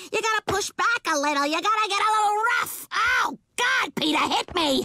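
building from eager to a breathless cry; getting worked up. Acted. Lois urging Peter to get rough.

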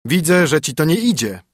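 husband giving his new wife chores, mildly stern. A narrator's reading. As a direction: order her about, lightly stern. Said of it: "rzekł mąż".

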